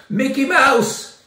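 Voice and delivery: yelled out. Retold or imitated. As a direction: bellow it out to startle everyone. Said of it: "He yelled out".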